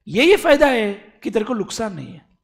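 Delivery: ordinary delivery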